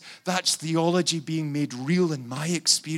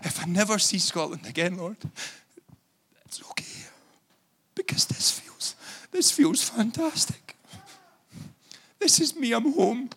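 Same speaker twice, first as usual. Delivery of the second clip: crying prayer; 4 semitones higher than usual. Real, not acted. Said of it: "he's crying his eyes out".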